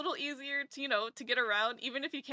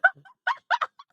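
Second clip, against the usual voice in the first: shrill burst of giggles, much higher and louder; high squealing laugh; 12 dB louder than usual. Real, not acted. Laughing at her own childhood stammer, high squeal.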